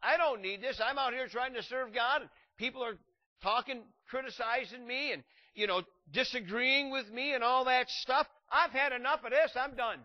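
loud, high, fed-up rant. Pretend. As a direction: rant like you've had enough. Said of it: "He should have said".

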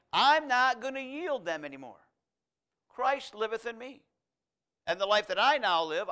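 rising, loud preacher's declaration; full-force preaching. Acted. Preacher voicing a believer's vow to the congregation, loud and rising. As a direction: proclaim it like a vow from the pulpit.

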